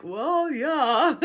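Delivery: high, loud awkward laugh through the words. Real, not acted. Answering the friend's praise of him, awkward loud laugh.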